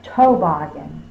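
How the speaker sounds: toboggan opened with "toe"; long o mistake. Shown as a demonstration. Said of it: "It's not toboggan".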